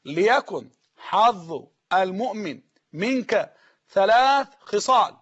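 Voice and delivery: very slow, each word set apart; slow dictation. Shown as a demonstration. Preacher repeating the saying for note-takers, very slow dictation. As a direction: read it slowly for those writing. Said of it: "إحنا بنكتب وراك".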